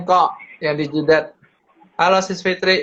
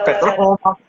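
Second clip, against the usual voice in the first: laughing; 6 semitones higher than usual. Real, not acted. Laughing at petromax joke.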